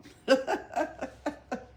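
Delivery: high bright laugh; spontaneous laugh; real laugh. Real, not acted. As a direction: laugh at the joke. Reader laughs at story.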